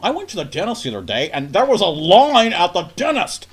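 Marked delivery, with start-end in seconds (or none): 1.3-3.5 raised, louder mock outrage; hammed-up shock at a trivial line; mock dramatic. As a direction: play it like a huge scandal. Mock dramatic dentist story.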